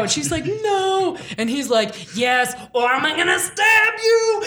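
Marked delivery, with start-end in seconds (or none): 0.5-1.2 high, drawn-out no; hammed-up reluctant refusal; mock distressed refusal. Seer refuses to tell the old man, mock distressed. 2.2-4.4 high, drawn-out threatening demand; hammed-up bullying, building to a cry; mock threat. Old man threatens the seer to keep talking, mock menace.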